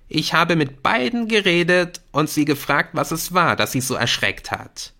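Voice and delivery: bitter schoolboy. A narrator's reading. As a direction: make the point with a bitter smile. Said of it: "Jamie lächelte bitter". (bitter und nachdrücklich)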